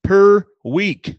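PER WEEK repeated loud and high, each word set apart; emphatic repeat. Real, not acted. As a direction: hammer "per week" home for effect. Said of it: "I repeat".

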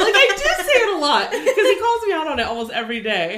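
high, loud, through laughter; helplessly amused confession; laughing. Real, not acted. Admitting Jeff catches her every day, laughing. Laughing about being called out.

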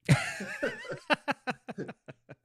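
sudden high-pitched laugh; high burst of laughter. Real, not acted. Laughing at own joke.

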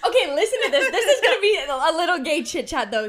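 high, loud laughing talk. Real, not acted. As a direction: laugh through the words. Bursting into laughter.

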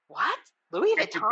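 imitating mom's shocked gasp at "Louis Vuitton"; mom surprised. Mom hearing that Louis Vuitton was looted, mock-shocked. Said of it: "And she was like".

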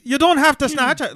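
high, loud mock Irish airport guard; Irish accent, high and loud, mocking the security guard. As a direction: ham up an Irish official telling her off. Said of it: "yeah, with an accent".

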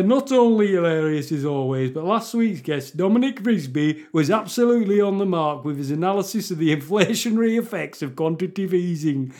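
broad mock Yorkshireman; hammed-up Geoffrey Boycott-style Yorkshire accent. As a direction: read it out as a put-on Yorkshireman. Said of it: "read it as a Yorkshireman".

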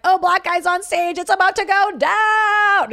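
high, loud excited crowd voice, slowing at the end; hyped crowd. Retold or imitated. Crowd hyped as a stranger walks on stage, high excited voice. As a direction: whoop like the crowd sensing drama. Audience hyped by surprise guest.

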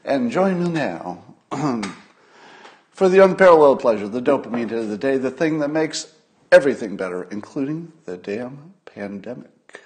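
building, grand ceremonial announcement; ritual recital. Acted.

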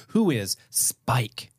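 slow quiz answer. Pretend. Answering a quiz question aloud.

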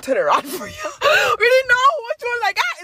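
high, loud crying-out; excited shouting. Real, not acted. Telling classmates to flip papers, excited shout. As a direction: near tears with excitement.

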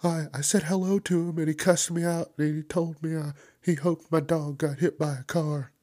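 lightly voiced gossip; retelling an interview complaint; imitating interviewee. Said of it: "they're like".

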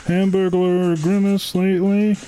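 lightly voiced dazed frog puppet; lazy, spaced-out Kermit, drifting; stoned Kermit the Frog. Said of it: "like stoned Kermit the Frog?".

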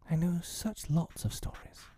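put-on high, coaxing girl's voice; entreating. A narrator's reading. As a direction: tempt him to stay, lightly pleading. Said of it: "she entreated".

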